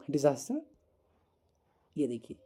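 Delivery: usual speaking voice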